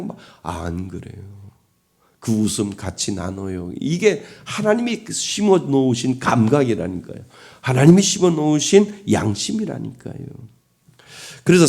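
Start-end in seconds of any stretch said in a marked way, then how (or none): none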